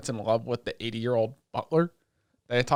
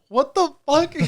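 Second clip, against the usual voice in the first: much higher, louder shocked yelp; shrieking disbelief; 7 dB louder than usual. Retold or imitated. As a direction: squeal it in total disbelief. Pot flirting with butler.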